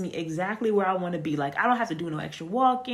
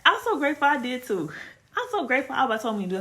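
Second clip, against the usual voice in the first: louder, higher, pouring out thanks; fervent gratitude; 6 semitones higher, 5 dB louder, faster than usual. Real, not acted. Woman telling listeners she is grateful, fervently. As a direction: testify it with full heart. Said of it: "emotional testimony of gratitude".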